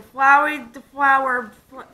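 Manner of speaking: mouth guard speech. Real, not acted.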